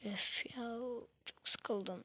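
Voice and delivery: normal talking voice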